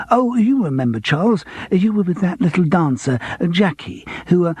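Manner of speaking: a hint of a fussy old pianist pressing; eager old pianist jogging memory; eager actor. A narrator's reading.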